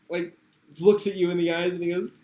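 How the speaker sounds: ordinary talk, nothing marked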